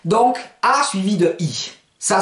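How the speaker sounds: ordinary talk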